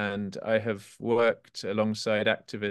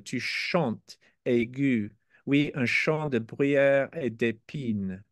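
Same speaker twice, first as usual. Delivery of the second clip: slow, measured French verse reading; carefully paced poetry reading in French; French poem recitation; 3 semitones higher than usual. Acted.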